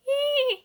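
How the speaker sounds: single very high imitated gull cry; seagull screech. A narrator's reading. Last gull struck by Marina's stone, screeching. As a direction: shriek like a gull that's been hit. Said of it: "brüllte die letzte Möwe".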